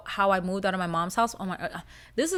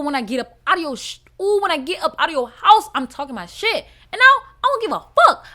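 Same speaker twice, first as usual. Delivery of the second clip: hype boast; 6 semitones higher, 9 dB louder than usual. Real, not acted. Excited boast after moving out.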